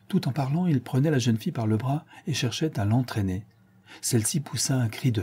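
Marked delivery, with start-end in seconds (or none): none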